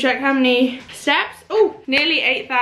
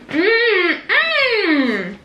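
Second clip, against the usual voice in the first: high, laughing mmm of pleasure; delighted mmm; 8 semitones higher than usual. Real, not acted. Tasting her granola for viewers, a delighted laughing mmm. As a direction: moan with delight at the taste.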